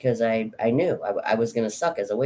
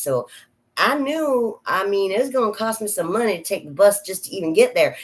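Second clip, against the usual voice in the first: higher-pitched, lilting Georgia Southern accent; 6 semitones higher than usual. Shown as a demonstration. Small-town Georgian scared of getting lost in Atlanta, Southern accent. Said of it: "the Southern accent ... pretend I'm from Georgia".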